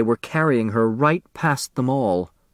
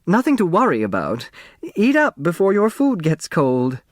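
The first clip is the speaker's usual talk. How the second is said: forced reassurance; 8 semitones higher than usual. Acted. Hostess reassuring guests after blast.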